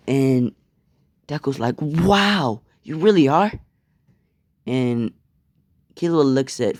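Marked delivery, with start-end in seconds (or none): 1.8-3.5 amazed. Acted. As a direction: react with wide-eyed wonder. Deku amazed by pro hero news.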